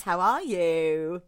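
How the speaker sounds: ordinary talk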